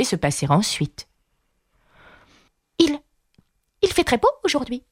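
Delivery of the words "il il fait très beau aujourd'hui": high, quick, stammered small talk; timid murmur. A narrator's reading. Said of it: "murmura une voix timide".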